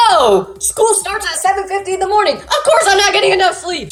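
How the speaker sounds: high, exasperated rant; mock outrage. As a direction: burst out in comic indignation. Outraged answer to sleep question.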